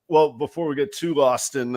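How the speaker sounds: usual speaking voice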